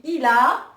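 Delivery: il a, final a stretched; Arabic word modeled. Shown as a demonstration. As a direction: say il a slowly, clearly. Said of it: "je l'ai réécrit ici".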